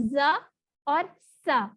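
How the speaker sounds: ordinary talk, nothing marked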